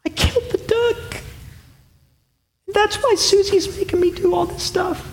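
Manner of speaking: put-on high, soft weeping child's voice; a little boy sobbing out his guilt; tearful confession. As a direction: confess through tears like a small boy. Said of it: "Through tears, he says".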